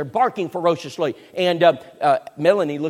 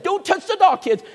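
urgent warning shout; 12 semitones higher, 5 dB louder than usual. Retold or imitated. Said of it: "So I said".

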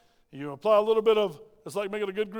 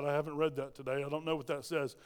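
mock mumble; 6 semitones lower, 8 dB quieter than usual. Mocking legalistic people.

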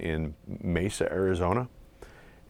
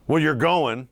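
raised, louder retold scolding; mock scolding; 9 semitones higher, 7 dB louder, slower than usual. Pitching coach sends player up to the big leagues, mock scolding. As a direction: imitate a coach telling you off, high and loud. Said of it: "now it's like he was scolding me".

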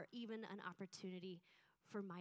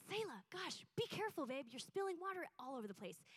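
snapped at her daughter, pitch jumping high; frustrated mom cutting her child down; sharp scolding; 5 semitones higher than usual. Retold or imitated. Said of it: "the way I said it".

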